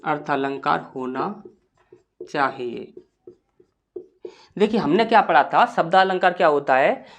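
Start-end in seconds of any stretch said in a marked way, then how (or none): 0.0-2.9 low, soft dictation with long gaps; quiet, measured note-taking voice; slow dictation. Shown as a demonstration. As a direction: dictate word by word, leaving long pauses.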